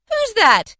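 very high, loud question; startled cry. A narrator's reading. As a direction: exclaim in astonishment. Fred startled by the visitor.

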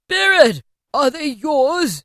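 high, loud, slow frightened question; frightened plea. A narrator's reading. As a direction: ask in shaken dread. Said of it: "Scrooge could say no more".

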